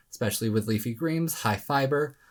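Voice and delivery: ordinary delivery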